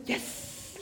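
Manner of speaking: quiet whispered cheer. Shown as a demonstration. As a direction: hiss it softly with contained excitement. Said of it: "little bit more excited".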